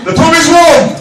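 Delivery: loud, high toast shout. Real, not acted. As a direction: call it out to the room. Calling out toast.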